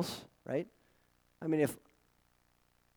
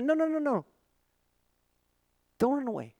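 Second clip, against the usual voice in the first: high-pitched coaxing, easing down; pleading reassurance; 9 semitones higher, faster than usual. Acted. God calming fleeing shepherds, pleading reassurance. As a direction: urge them gently to stay. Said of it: "God was telling this message".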